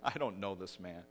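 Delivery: understated denial, not actually shouted; retold first denial. Preacher retells Peter's first denial to servant girl, understated. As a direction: deny it firmly at an ordinary volume. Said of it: "Peter just shouts".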